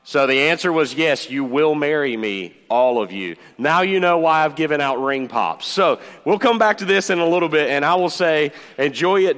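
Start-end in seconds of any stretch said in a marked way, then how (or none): none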